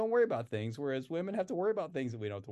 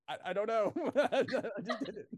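sheepish laugh; 6 semitones higher than usual. Retold or imitated. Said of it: "I'm like".

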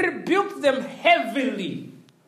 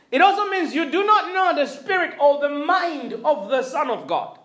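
usual speaking voice

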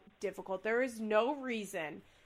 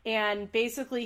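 ordinary talk, nothing marked

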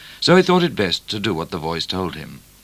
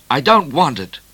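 higher, louder angry refusal; furious; 6 semitones higher, 6 dB louder than usual. A narrator's reading. Midnight refusing the purse from O'Grady, furious. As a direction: throw it back at him in anger. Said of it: "said Midnight furiously".

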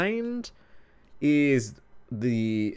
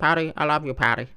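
bad Mandy impression; 3 semitones higher than usual. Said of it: "It's bad. I'll work on it".

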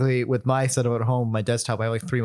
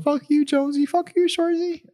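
quoting Shorzy; 13 semitones higher than usual. Retold or imitated. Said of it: "Canadian show on Hulu".

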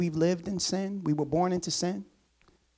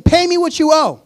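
higher, much louder furious demand; choking demand; 8 semitones higher, 10 dB louder than usual. Acted. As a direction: bark it while choking him. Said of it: "choking him".

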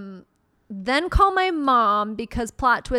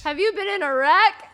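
higher, mock billboard-ad pitch; lawyer ad voice; 7 semitones higher than usual. Reading the lawyer billboard aloud as a mock ad. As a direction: hawk it like a law-firm ad. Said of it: "Alexander Shunnarah billboard".

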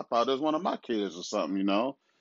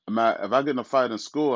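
ordinary delivery

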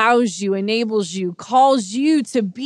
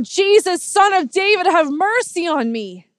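high, loud cry for mercy; imitated yell; 8 semitones higher, 5 dB louder than usual. Blind beggar calls to Jesus passing by, yelling. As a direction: cry out over a crowd, pleading. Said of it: "he yells out".

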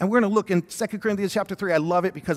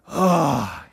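frustrated groan; 5 semitones lower than usual. Retold or imitated. Dad retelling his late-night trampoline struggle, frustrated groan. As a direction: let out a fed-up groan. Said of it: "I made that audible, like".

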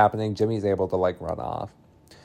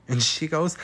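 ordinary talk, nothing marked

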